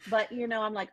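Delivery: ordinary talk, nothing marked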